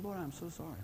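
soft, hushed apology; humble apology. Retold or imitated. Pastor retells apologizing to God, soft and contrite. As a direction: say it softly, contrite.

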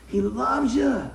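slow, heavy stress on "loves"; emphatic LOVES. Shown as a demonstration. Preacher correcting a doubter about God's love, emphatic "loves".

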